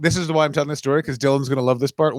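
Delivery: normal talking voice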